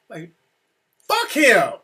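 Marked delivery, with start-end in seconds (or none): 1.1-1.7 higher, louder, mad outburst; retold angry wife. Wife back from the restroom to waiting husband, retold angry. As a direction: imitate an upset wife's outburst. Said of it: "she comes out mad".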